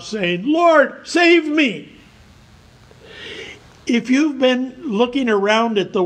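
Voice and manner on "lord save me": higher-pitched plea for rescue; crying out. Acted. Peter beginning to sink, calling to the Lord, crying out. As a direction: cry out as if starting to sink. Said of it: "he cried out".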